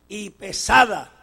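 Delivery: ordinary delivery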